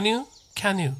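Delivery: "can you" joined into "kya-nyu"; linked pronunciation. Shown as a demonstration. Teacher demonstrates how "can you" links into "kanyu". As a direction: let the n of "can" start "you". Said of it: "canの文章はくっついて".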